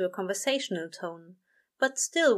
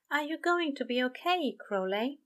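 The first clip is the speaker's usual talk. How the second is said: higher, gentle worried question; concerned angel; 7 semitones higher than usual. A narrator's reading. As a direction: angel checking on his friend.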